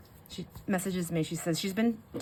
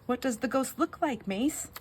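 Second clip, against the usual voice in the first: put-on higher, careful question to a child; mom to toddler; 7 semitones higher than usual. Mom asking toddler about the ghost, imitated gentle high voice. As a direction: coax it out of a little one. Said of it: "And she was like".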